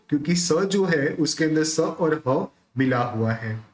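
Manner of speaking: usual speaking voice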